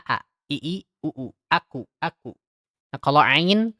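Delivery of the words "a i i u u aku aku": open, unpressed a i u from the throat; a i u said lightly and open; free hamzah vowels. Shown as a demonstration. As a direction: let each vowel out freely with a clean glottal start. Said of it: "kayak nyebutin a".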